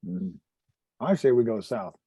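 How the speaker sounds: ordinary delivery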